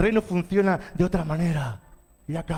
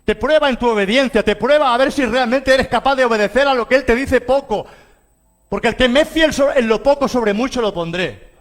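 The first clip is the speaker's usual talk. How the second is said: raised, louder preaching voice; leidenschaftliche Predigt; 6 semitones higher, 7 dB louder than usual. Real, not acted. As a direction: preach it with force to the congregation. Preaches at full force.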